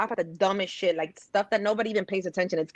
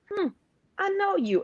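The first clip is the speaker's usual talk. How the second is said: quoting; 10 semitones higher, 3 dB quieter than usual. Retold or imitated. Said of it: "This is the quote where he goes".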